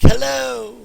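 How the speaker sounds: high loud call into a cave; cartoon cave shout. Shown as a demonstration. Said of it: "they walk into a cave and they're like".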